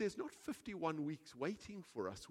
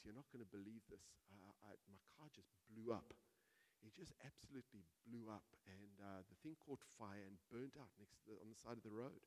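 hushed phone news; 13 dB quieter than usual. Retold or imitated. Friend phones with terrible news.

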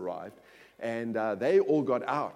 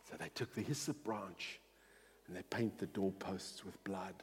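quiet solemn preaching; 4 semitones lower, 3 dB quieter than usual. Acted.

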